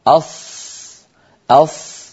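أص said with pursed forward lips; أص pouted, lips pushed out; rounded-lip ṣād. Shown as a demonstration. Teacher demonstrating the mistaken rounded أص. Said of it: "أص هكذا ويزم شفتيه".

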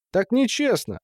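put-on high-pitched childish protest; balking boy. A narrator's reading. Boy objects to being sent out, balking. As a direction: protest like a put-upon kid. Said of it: "заортачился мальчик".

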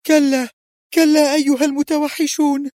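high, desperate shriek of horror; terrified scream. A narrator's reading. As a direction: scream out in sheer terror. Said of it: "صرخت منى في رعب هائل". (صراخ رعب هائل)

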